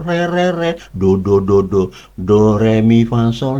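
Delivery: normal talking voice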